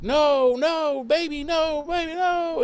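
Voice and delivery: a half-hearted imitation of a sobbing plea; Willow crying. Retelling Willow's grief over Tara, mock crying. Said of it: "crying, obviously, and screaming".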